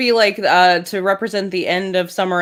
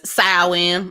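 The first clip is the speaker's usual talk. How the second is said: Memphis way. Retold or imitated. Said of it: "as we say here in Memphis".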